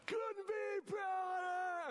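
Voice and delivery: very high, drawn-out yell; screamed school cheer. Retold or imitated. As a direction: kids straining throats at a pep rally. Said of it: "Kids just screaming".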